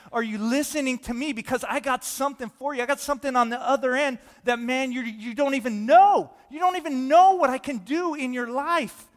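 loud, high, building to a peak; rising fervent appeal; impassioned preaching. Acted. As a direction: call out urgently as God to the listeners. Preacher voices God, building to climax.

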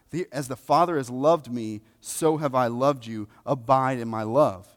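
impassioned preaching. Real, not acted. Preacher to congregation on coming to Scripture in faith, impassioned preaching. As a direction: preach it with force, pitch up and loud.

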